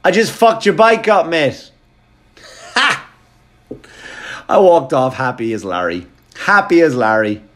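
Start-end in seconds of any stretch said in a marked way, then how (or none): 0.0-1.7 gloating taunt. Retold or imitated. Taunting through car window. 2.7-3.1 triumphant ha. Retold or imitated. Triumphant laugh after taunt.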